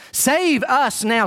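"Save us now" drawn out very slowly, each word weighted; slow emphatic plea. Shown as a demonstration. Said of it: "Originally, it meant to save us".

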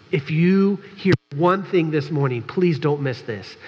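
ordinary talk

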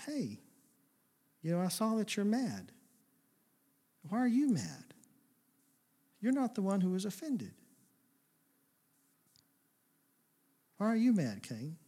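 soft, tender voice of a caring father; softly voiced loving God asking his son; gentle loving God. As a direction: coax him gently, almost hushed. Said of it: "God was loving and gentle".

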